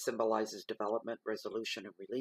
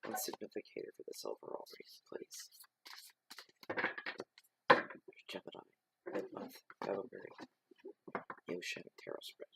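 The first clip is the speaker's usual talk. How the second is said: whispered invocation. Real, not acted. Reader whispering to the tarot deck, asking for a card. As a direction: hush it like a ritual request.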